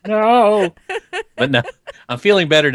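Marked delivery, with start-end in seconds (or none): 0.1-0.7 pleading that builds higher and louder; mock protest. Retold dismay at getting sick. 1.5-1.6 mock wailing protest. Retold dismay at getting sick.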